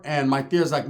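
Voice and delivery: ordinary delivery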